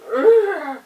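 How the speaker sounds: loud exasperated urgh; sudden loud fed-up groan; frustrated groan. Real, not acted. Frustrated restless legs groan.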